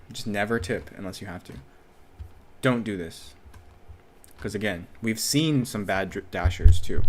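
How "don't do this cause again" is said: usual speaking voice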